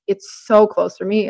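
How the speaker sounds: normal talking voice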